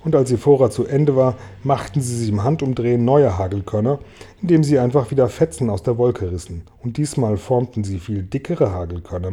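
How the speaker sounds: usual speaking voice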